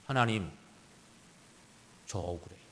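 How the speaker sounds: low, very soft acted plea; quietly imagined prayer of grievance; hushed aggrieved prayer. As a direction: murmur a wronged man's prayer, pausing between phrases. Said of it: "모세는 아마도 이렇게 기도했다고 봅니다".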